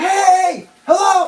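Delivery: high, loud yell. Retold or imitated. Yelling at the driver through the window, high and loud. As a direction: call out to get her attention. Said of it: "I'm yelling".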